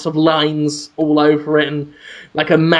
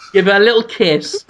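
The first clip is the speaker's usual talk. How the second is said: normal talking voice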